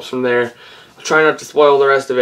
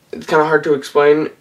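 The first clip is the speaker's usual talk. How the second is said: normal talking voice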